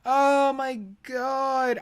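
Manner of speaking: weary disbelief, drawn out; slow exasperated groan. Real, not acted. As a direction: sigh it out weary.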